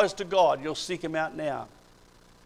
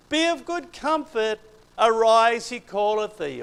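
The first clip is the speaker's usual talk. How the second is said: high, sweetly coaxing crowd voice; sudden honeyed switch to kindness; changed tune; 6 semitones higher, 5 dB louder than usual. Retold or imitated. Crowd now gently coaxing the blind man to rise. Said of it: "they changed their tune".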